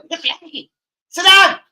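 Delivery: high-pitched shouted put-down; mock telling-off yell. Streamer tells someone off, high shouted mock order. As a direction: build to a loud yelled order.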